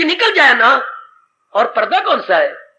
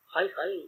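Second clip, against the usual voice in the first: soft mock lament; 6 semitones lower, 16 dB quieter than usual. Preacher sighs in lament.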